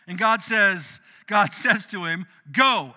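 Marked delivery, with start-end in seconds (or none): 2.5-2.9 a loud, firm one-word command; exclaimed. Acted. God ordering a reluctant Ananias to go, loud command. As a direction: snap the order out. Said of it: "exclamation point".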